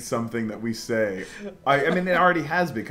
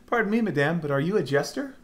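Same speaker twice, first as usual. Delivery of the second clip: put-on higher courtly girl voice; princess voice; 8 semitones higher, 4 dB louder than usual. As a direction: ask like a polite young princess. Reading heroine line in voice.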